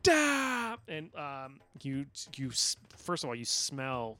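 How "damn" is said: loud high-pitched mock scream; one long, high, loud yell; hammed-up scream at full pitch. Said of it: "you just hear him screaming".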